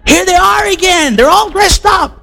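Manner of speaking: high, shouted heads-up; excited shout that they're here; excited alert. Retold or imitated. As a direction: call it out like you just spotted them coming. Spotting visitors coming down the block.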